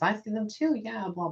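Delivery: high, sing-song blah-blah mimicry; mock her offhand claim; high mock of the girl brushing it off; mocking dismissive voice.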